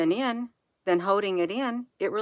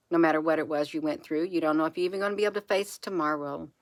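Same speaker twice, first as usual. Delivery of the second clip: ordinary delivery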